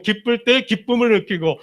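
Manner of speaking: ordinary talk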